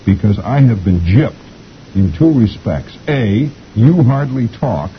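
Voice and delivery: slow, emphatic, rising in pitch; indignant parakeet. A narrator's reading. Parakeet's farewell note to Arthur, indignant and deliberate. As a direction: read out the grievances with mock indignation. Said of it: "This is the way a parakeet writes".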